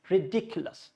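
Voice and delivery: ridiculous as ri-DIK-yu-lus; ridiculous with a clear DIK; stressed DIC in ridiculous. Shown as a demonstration. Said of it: "dick should be clear".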